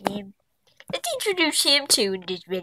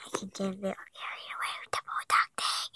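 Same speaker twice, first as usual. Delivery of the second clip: whisper; 12 dB quieter, faster than usual. Real, not acted. Embarrassed aside after outburst.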